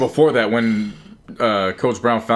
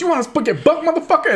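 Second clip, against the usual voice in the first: mock threatening player. Quarterback threatening the receivers coach, mock angry yell. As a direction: square up and challenge him. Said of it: "he goes".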